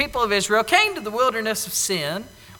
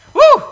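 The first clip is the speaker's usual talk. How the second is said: excited whoop; 14 dB louder than usual. Retold or imitated. Celebrating finding water.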